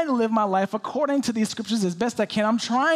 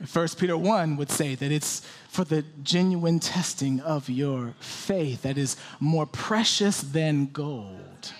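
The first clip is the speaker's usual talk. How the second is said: normal talking voice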